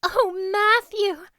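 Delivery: put-on girl running to tell her father figure; joyful exclamation. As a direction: call out to him in delight. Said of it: "exclaimed Anne".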